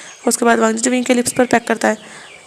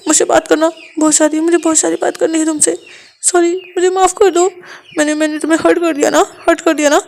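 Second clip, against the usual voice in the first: tearful apology; 5 semitones higher than usual. Acted. Wang ji scolds Wing then tearfully apologises, childlike. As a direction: sulk then beg forgiveness.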